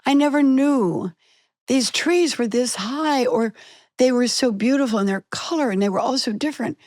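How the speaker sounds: put-on childlike wonder, voice lifted high. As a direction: marvel at the trees like a child. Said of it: "and I went".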